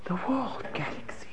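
whispered in wonder; awed whisper. Shown as a demonstration. Rabbi whispering to students in awe. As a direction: breathe it out in awe. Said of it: "my intonation, my voice".

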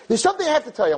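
put-on high, quick earnest girl voice; a young woman leaning in with news; imitating girl. Girl voice repeated.

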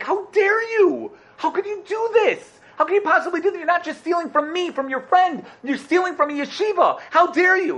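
very high, outraged acted yelling; angry outburst. Rosh Yeshiva accuses his friend of stealing from yeshiva, acted angry outburst. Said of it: "You don't have to get so angry".

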